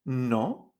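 "No" starting low and sweeping up; doubtful rising tune. Shown as a demonstration. Teacher likens second tone to doubting, rising "no". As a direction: draw "no" upward like a wavering question. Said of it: "Subes hacia arriba".

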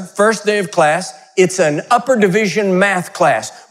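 ordinary talk, nothing marked

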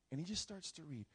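usual speaking voice

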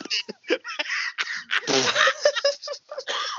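high, squealing burst of laughter; real helpless laugh, near a shriek; high squealing laugh at the joke; laughing hard. Real, not acted. Cracking up at the joke.

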